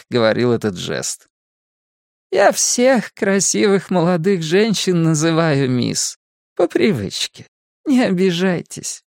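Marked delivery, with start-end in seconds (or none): 2.3-9.0 lightly voiced old lady, pitched higher; a hint of a polite old hostess, higher than narration; old Mrs. Kersh. A narrator's reading. (слегка озвученная пожилая дама, повыше)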